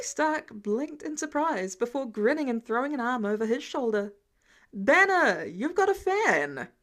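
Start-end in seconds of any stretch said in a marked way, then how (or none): 4.7-6.7 loud, slow call across the lab; a cheerful shout to a colleague; excited call. A narrator's reading. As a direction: call out gleefully to Banner. Stark calls out excitedly.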